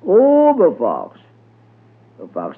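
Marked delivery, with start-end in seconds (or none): 0.1-1.2 high, drawn-out calling holler. A narrator's reading. Brer Rabbit calls out to Brer Fox, hollering high. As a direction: holler out for someone, stretching the Oh. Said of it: "he hollered out".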